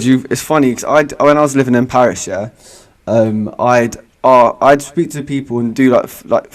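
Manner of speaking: ordinary talk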